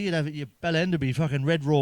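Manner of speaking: ordinary delivery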